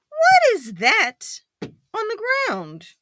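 high, loud, drawn-out storybook witch; witch's question. Acted. Witch asking about the ground, high and slow. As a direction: pitch it way up in astonished alarm. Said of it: "The witch points underneath and asks".

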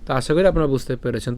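normal talking voice

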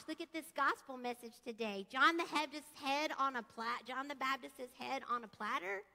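ordinary talk

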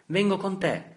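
high, loud frightened plea; the boy begs not to be left behind. A narrator's reading. Boy to his father, frightened and pleading.

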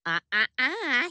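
playful scold. Pretend. Pretend toll booth scene.

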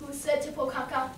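plain reading voice

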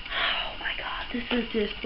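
very soft, voiceless awe; hushed breath of disbelief; overcome whisper. Real, not acted. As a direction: whisper it, overwhelmed. Too overcome to go on.

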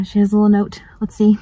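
usual speaking voice

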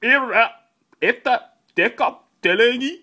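loud, high fake German; mock German. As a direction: bluff through it in hammed-up German. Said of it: "Now in German".

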